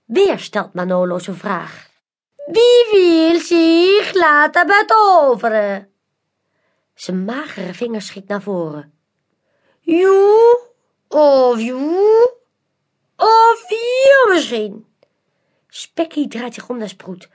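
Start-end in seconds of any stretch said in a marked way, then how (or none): 2.5-5.9 high, slow, theatrical magician voice; mysterious magician. A narrator's reading. Magician Manolo invites the crowd, slow and theatrical. 9.8-14.9 high, slow, theatrical magician voice; mysterious magician. A narrator's reading. Magician Manolo invites the crowd, slow and theatrical. Manolo points at audience members.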